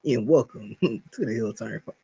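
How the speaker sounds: low-pitched, hushed imitation of co-host; mock podcast intro. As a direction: mimic his intro way down low, laughing midway. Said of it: "You insinuated he".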